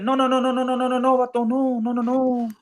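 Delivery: soft, high, hushed refusals; a hint of Oscar's hushed refusal; hushed Oscar De La Hoya. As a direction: wave it off quietly like a nervous promoter. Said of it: "Oscar De La Hoya said".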